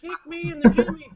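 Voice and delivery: very high joking falsetto; mock squeaky plea. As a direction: squeak it like a silly pleading victim.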